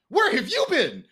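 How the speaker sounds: high, loud, laughing question; laughing excited welcome. Retold or imitated. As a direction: greet an old friend with a delighted laugh. Excited greeting of returning actor.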